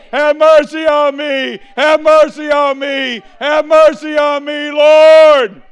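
loud, high pleading shout; passionate cry. Acted. Lepers crying out to Jesus, full-voiced shouting plea. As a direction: cry out for mercy with everything. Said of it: "start saying that with passion".

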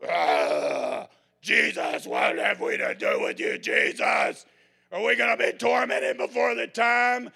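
loud, raspy, breathy demon shout; demonic voice. Acted. Possessed man screaming at Jesus, raspy demonic shout. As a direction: snarl it like a tormented demon.

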